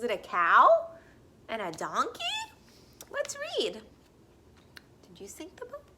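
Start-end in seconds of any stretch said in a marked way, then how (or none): 5.1-5.8 whisper. Acted. Teacher teases reader in hushed aside.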